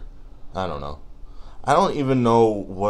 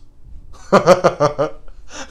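laughing; 8 semitones higher, 4 dB louder than usual. Real, not acted. Laughs at own joke.

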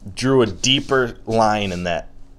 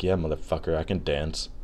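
lightly voiced solemn champion, softer and lower; dead-serious disco champion; 4 semitones lower, 8 dB quieter than usual. Disco champion posing for his photo, mock-serious and quiet. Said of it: "his face is so serious".

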